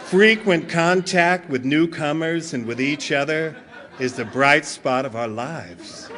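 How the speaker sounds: deep, sultry late-night announcer reading; smooth sales pitch. Acted. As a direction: sell it low and silky, like a cheesy commercial. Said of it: "just imagine Bill and Bob, late night".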